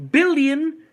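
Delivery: punchy loud repeat of billion; emphatic repeat. Shown as a demonstration. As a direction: hammer billion home a second time. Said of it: "billion with a B".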